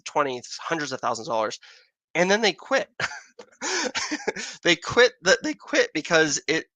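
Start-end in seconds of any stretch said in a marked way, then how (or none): 2.1-6.7 high-pitched, breaking into a real laugh. Real, not acted. Astronomer laughing over beginners who spend big then quit, amused. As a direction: laugh through it, pitch jumping up.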